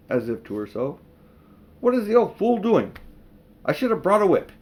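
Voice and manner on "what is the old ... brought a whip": higher and louder irritated queen; impatient witch. A narrator's reading. Impatient witch queen grumbles to herself, irritated. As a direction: snap at the empty room.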